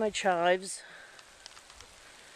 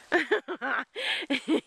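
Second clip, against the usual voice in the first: amused laugh; 9 semitones higher than usual. Real, not acted. Laughs at own crude joke.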